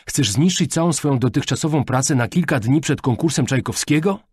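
agitated parental rebuke; heated reproach. A narrator's reading. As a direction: scold her for throwing it all away. Father reproaches daughter.

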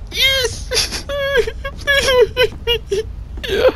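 high squeaky laugh breaking into a cry; near-tearful giddy squeal. Real, not acted. Relieved after escaping.

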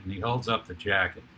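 normal talking voice

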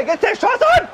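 shouting at a big shot; high excited yell. Real, not acted. Shot in the game.

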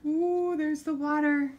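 high, loud delighted ooh; excited. Acted. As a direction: wow the kids with the discovery. Excited discovery in book.

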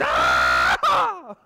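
loud, far-off-sounding imitation of wife's scream in labor; imitated labor scream. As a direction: belt out her painful cry the way she yelled. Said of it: "You know, Stacy's".

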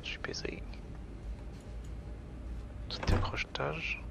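breathy hushed whisper. Real, not acted. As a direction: whisper so the monster won't hear. Hiding, monster near.

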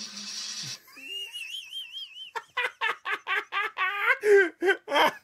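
laughing fit. Real, not acted. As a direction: burst into helpless high laughter. Reacting to funny clips.

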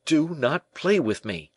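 high, slow, restrained plea; a touch of desperate appeal, drawn out slowly; pleading cry. A narrator's reading. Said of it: "cried the prince".

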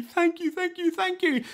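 very high delighted thanks; happy squeaky mice. Acted.

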